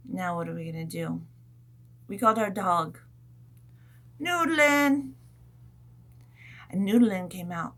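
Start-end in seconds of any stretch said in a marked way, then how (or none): 4.2-5.1 higher, louder call; calling the dog. Retold or imitated.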